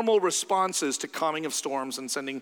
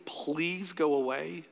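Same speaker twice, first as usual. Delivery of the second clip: pleading; 4 dB quieter, much slower than usual. Retold or imitated. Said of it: "They beg him to go away".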